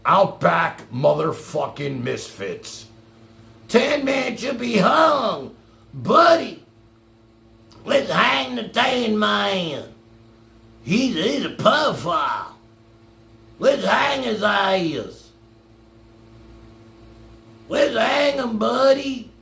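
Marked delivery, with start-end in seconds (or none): 3.7-15.3 slow, higher mock Australian yelling; mock Aussie lynch mob. Mocking the critic's fans in a slow, higher Australian yell. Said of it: "they don't talk like that where I'm from". 17.7-19.3 slow, higher mock Australian yelling; mock Aussie lynch mob. Mocking the critic's fans in a slow, higher Australian yell. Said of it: "they don't talk like that where I'm from".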